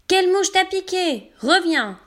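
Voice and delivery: appel haut et plus fort vers la chèvre qui s'enfuit; cri à la chèvre. Acted. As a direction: crier après la chèvre, aigu. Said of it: "Il crie à sa chèvre". (crie fort et aigu vers la chèvre)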